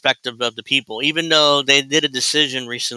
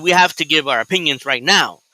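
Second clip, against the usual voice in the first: usual speaking voice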